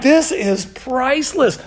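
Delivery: high, slow, relishing every word; delighted kid. Retold or imitated. Eleven-year-old loving Elijah's toilet joke, savoring delight. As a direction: imitate a kid drawing out sheer delight. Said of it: "I'm like".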